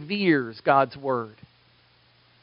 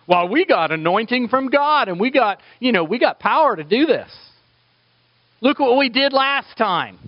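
higher, louder cocky bragging; mock boast; 8 semitones higher, 4 dB louder than usual. Preacher mimicking boastful disciples, cocky. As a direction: puff up like overconfident disciples. Mocking disciples' self-reliance.

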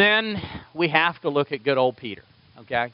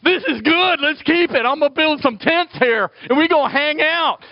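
much higher, louder, thrilled mock Peter; excited Peter; 11 semitones higher, 4 dB louder than usual. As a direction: gush like a kid who never wants to leave. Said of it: "poor Peter's like".

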